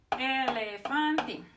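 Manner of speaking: "Elefante" slow and stretched, as a model; sounding out vowels. Shown as a demonstration. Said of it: "always the same".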